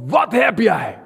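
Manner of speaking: passers-by stopping the preacher, irritated and loud. Retold or imitated. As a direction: imitate annoyed strangers. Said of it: "En gewoon geïrriteerd".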